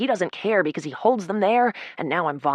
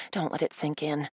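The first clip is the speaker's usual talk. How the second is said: usual speaking voice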